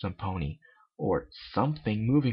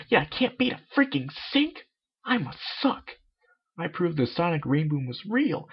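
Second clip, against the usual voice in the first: high, rising self pep talk; indignant Rainbow Dash; 6 semitones higher than usual. Acted. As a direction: work yourself up, scoffing at your own fear. Voices Rainbow Dash pumping herself up.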